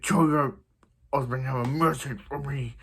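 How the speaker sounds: mock-menacing superhero voice. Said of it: "covering his voice is his Batman impression".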